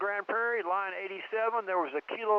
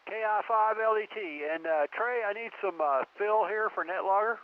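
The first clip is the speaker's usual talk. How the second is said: distant radio transmission; crackly military radio; 7 semitones higher than usual. Real, not acted. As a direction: voice over a radio link. Net logger over radio.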